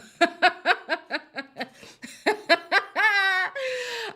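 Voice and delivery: loud high burst of laughter; big loud laugh. Real, not acted. Laughing at her own roast, loud laugh.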